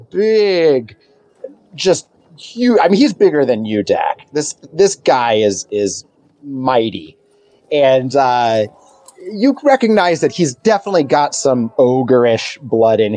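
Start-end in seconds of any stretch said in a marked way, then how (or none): none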